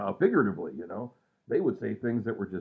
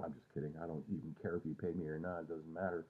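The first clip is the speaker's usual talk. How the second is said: soft aside; 5 semitones lower, 13 dB quieter than usual. Real, not acted. Soft aside after joke.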